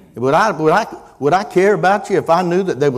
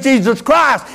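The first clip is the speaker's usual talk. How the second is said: Savior's name preached high and forceful; reverent proclamation; 12 semitones higher, 6 dB louder than usual. Real, not acted. Preacher naming the Savior to the church, forceful proclamation. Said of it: "a name that you can flip out there".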